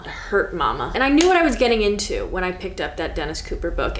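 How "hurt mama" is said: lower, sulky echo of hurt mama; low hurt repeat. Acted. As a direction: drop the voice and pout.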